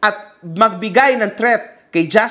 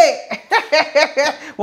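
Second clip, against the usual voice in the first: real laugh; 4 semitones higher than usual. Real, not acted. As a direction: open amused laughter. Laughs at his own joke.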